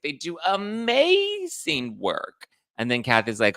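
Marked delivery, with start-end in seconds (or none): 0.0-2.5 high, very slow, put-on posh accent; Dorit's accent. Dorit insisting on the charity in her drawn-out put-on accent. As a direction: draw out amazing grandly. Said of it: "Dorit's like".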